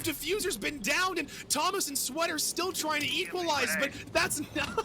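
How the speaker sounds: high-energy play-by-play yell; excited shout. Real, not acted. Double kill during live cast.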